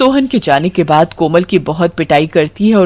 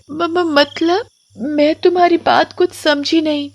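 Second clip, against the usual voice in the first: put-on high child voice, softer and hesitant; stammering little girl; 9 semitones higher, 5 dB quieter than usual. Timid Komal stammers at the witch, high and soft. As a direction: stutter nervously like a timid child.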